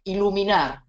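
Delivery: usual speaking voice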